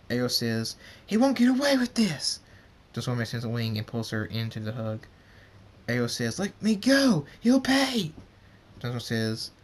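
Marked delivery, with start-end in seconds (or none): 1.0-2.4 high, loud furious cry; furious outburst. Acted. Ariel vows revenge, furious. 6.4-8.1 high, loud raging yell; furious struggling shout. Acted. Ariel struggles in hug, furious.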